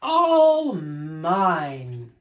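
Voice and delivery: loud gloating proclamation; triumphant cry. Acted. Yertle atop the turtle stack, loud triumphant cry. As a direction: crow over everything below. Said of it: "Yertle cried".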